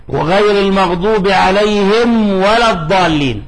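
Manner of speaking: measured tajweed recitation; Quran recitation. Shown as a demonstration. Teacher quotes the verse for students, recited. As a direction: recite it as scripture. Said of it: "كما في قوله تعالى".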